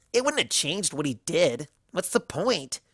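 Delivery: lightly voiced brash mare; dismissive scoff. A narrator's reading. Rainbow Dash dismissing Everfree, scoffing. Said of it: "Rainbow Dash scoffed".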